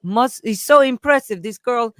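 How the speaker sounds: bad imitation of an Indian accent; lightly put-on Indian accent. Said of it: "I'm doing the worst accent".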